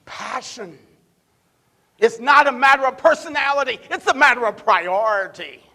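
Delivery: fervent quoted proclamation; passionate declaration. Retold or imitated. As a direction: preach it like a rallying cry. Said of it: "Here's what he said".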